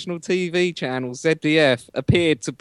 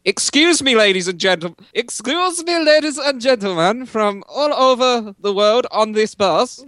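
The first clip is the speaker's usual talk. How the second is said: lightly put-on German accent, higher pitched; mock German accent; 6 semitones higher than usual. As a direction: play the German bus driver, accent a bit hammed-up. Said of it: "'Cause you went from Germany with that accent".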